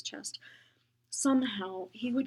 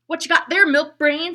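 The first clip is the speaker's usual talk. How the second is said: loud, high jeering bully; a bully's loud high taunt; 8 semitones higher, 18 dB louder than usual. Acted. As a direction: sneer it across the bus. Bully taunting Henry on bus.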